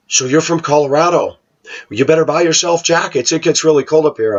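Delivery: high, chirpy neighbor voice, rushing the warning; mock-friendly Minnesota neighbor; know-it-all neighbor. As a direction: play the helpful local who knows best.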